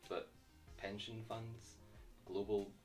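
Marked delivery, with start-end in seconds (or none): none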